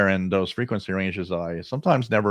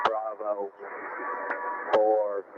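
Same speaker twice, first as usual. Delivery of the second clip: robotic radio voice; 5 dB quieter, much slower than usual. Real, not acted. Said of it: "Sounds so creepy".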